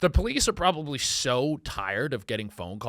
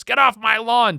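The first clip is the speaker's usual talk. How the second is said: mock grumpy yell; 7 semitones higher, 8 dB louder than usual. Grumpy homeowner yelling.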